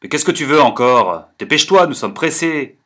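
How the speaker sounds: plus fort, agacé et brusque; grognement furieux. Acted. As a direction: rembarre l'importun avec colère. (un ami furieux qui grogne)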